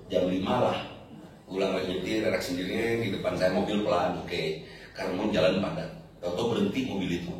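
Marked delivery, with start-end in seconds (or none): none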